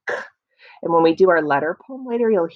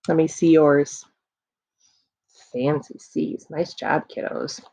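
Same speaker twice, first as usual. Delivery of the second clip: normal talking voice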